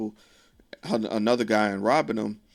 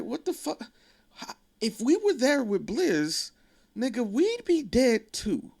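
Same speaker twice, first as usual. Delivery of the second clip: mock high Q voice; 13 semitones higher than usual. Q snapping back at Bishop.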